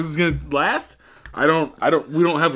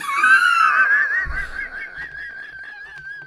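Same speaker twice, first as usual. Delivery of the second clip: long high laugh. Real, not acted. At his own lunges pun, laughing hard. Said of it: "wait for you to stop laughing".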